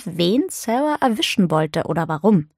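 ordinary delivery, nothing marked

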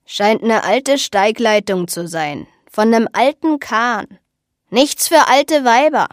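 put-on loud, slow old crone; cranky old seer, drawn out and emphatic; old eccentric woman. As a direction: crow it out slowly like a batty old woman.

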